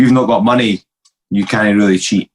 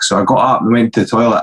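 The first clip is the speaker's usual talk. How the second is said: usual speaking voice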